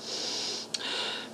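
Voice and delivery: long weary sigh through the nose; breathy fed-up sigh; exasperated sigh. Retold or imitated. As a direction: sigh like you've said it a hundred times. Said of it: "And Jack's Galaxy is like".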